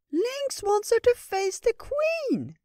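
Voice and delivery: very high, slow little creature voice; tiny high creature, worried; squirrel voice. Acted. Ratatosk voice.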